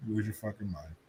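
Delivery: ordinary delivery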